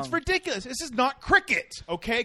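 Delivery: high, loud exasperation; exasperated. Real, not acted. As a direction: rant it, fed up and loud. Exasperated rant about game length.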